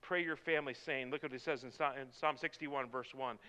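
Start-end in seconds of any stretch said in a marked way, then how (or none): none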